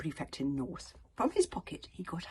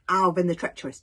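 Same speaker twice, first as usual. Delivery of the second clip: ordinary delivery, nothing marked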